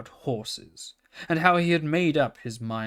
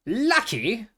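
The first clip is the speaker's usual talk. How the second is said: snorted; 10 semitones higher than usual. A narrator's reading. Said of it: "snorted Merrylegs".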